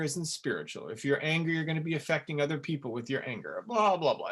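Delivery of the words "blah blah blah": dismissive blah blah. Acted. Mocking spiritual junk sayings.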